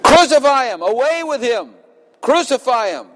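high shouted cry of the mob; mob's cry. Acted. Preacher to congregation, voicing the crowd: high shouted crucify. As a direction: yell it like the angry crowd.

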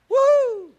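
high excited whoop; joyful whoop. Real, not acted. Preacher whooping with joy at his congregation. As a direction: let out a loud joyful woo. Preacher exults mid-sermon.

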